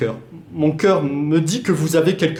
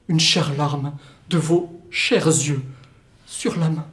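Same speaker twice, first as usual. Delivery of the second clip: normal talking voice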